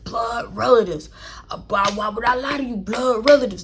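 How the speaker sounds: lightly mocked guy repeating himself; imitating him. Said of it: "He was like".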